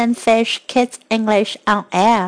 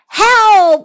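high, loud frightened shout; scared cry for help; 8 semitones higher, 6 dB louder than usual. Acted. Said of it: "said Fluffy".

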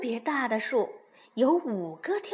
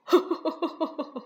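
bear laugh. A narrator's reading. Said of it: "大熊就笑了起来".

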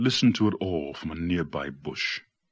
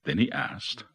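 plain reading voice

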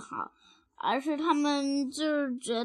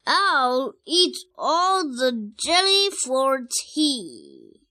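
loud, slow, drawn-out threat; 5 dB louder, much slower than usual. Acted. Mother threatening her squabbling twins, very loudly. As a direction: belt it out word by word. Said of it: "said Missus Tyrannosaurus very loudly".

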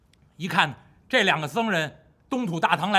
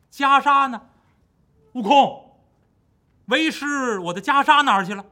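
louder, urgent and quick at the end; anxious master; 6 semitones higher, 7 dB louder than usual. Acted. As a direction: call for Wukong in alarm. Monk frantic over lost robe. (唐僧焦急地喊悟空)

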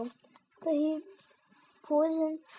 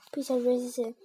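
plain reading voice

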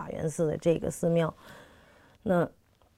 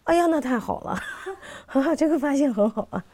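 higher-pitched, pleased exclamation with a laugh; delighted reaction; 7 semitones higher than usual. Retold or imitated. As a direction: imitate an older scholar's delighted surprise, laughing. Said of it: "李敖先生说".

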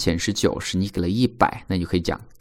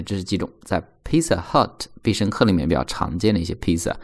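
ordinary talk, nothing marked

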